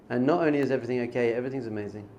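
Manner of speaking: ordinary talk, nothing marked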